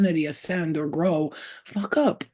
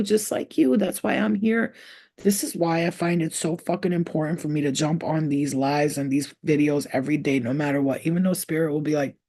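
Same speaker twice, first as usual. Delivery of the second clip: usual speaking voice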